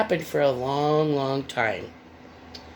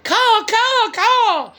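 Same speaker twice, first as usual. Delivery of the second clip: high loud cawing crow; 9 dB louder, much slower than usual. A narrator's reading. Crow warns of the fox with a high loud caw. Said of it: "called the crow".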